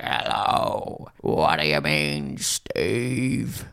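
imitating a creepy low voice; a hint of a creepy masked boy; lightly voiced creepy whisper-talk. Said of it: "Steve keeps doing this voice".

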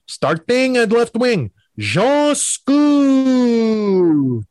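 high, slow, stretched arena announcement; PA announcer. Retold or imitated. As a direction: call the lineup like a French-Canadian PA voice. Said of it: "They said".

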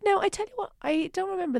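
jumps up in pitch; high emphatic. Real, not acted. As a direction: burst out high.

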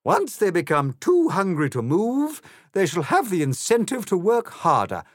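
high, loud grandiose queen voice; haughty Queen. A narrator's reading. Queen boasts to Alice about her reign, grandiose. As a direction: proclaim like a self-satisfied monarch.

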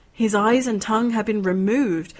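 plain reading voice

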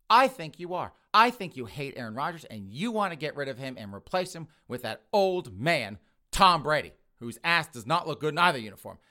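loud, mock-outraged accusing rant; angry accusation. Girlfriend accusing the boyfriend's mother, angry. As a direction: hammer each charge home loudly. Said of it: "gets really, really angry with Miss Peterson".

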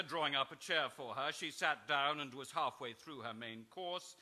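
ordinary talk

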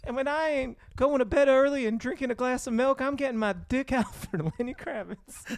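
high-pitched country kid impression. Yodeling kid pledging support, high-pitched impression. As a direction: lift it into a little cowboy's voice. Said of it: "this is [name]".

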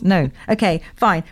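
ordinary talk

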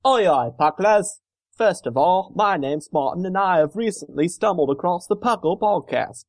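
attempted Cockney English accent; amateur English accent, drifting toward Australian. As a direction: read it in a put-on working-class English accent. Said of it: "an English accent".

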